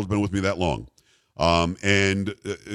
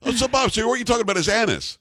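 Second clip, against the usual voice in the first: mock innocent; 11 semitones higher than usual. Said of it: "I'm like".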